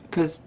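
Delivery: soft resigned sigh; whispered sigh. Real, not acted. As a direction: sigh it out under breath. Hoping, sighs.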